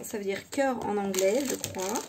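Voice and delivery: ordinary talk, nothing marked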